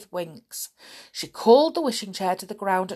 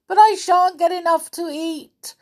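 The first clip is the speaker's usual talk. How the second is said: high, loud, self-pitying wail; 9 semitones higher, 8 dB louder than usual. A narrator's reading. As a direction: whine about missing dinner. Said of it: "wailed Winks".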